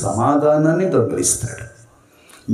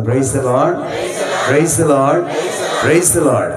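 praise call; 3 semitones higher, 3 dB louder, much slower than usual. Real, not acted. Leading congregational praise call.

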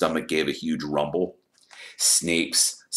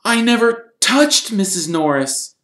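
high, raised-voice protest; loud denial; 11 dB louder than usual. A narrator's reading. Harry denying the attack before everyone, loud. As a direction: protest innocence loudly. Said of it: "said Harry loudly".

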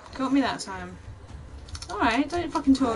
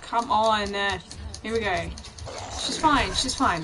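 ordinary delivery

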